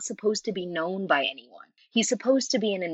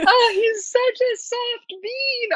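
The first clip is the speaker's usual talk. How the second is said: high, slow, louder gush over him; doting gush; 5 dB louder, slower than usual. Retold or imitated. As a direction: draw it out like he's adorable. Gushing over the character.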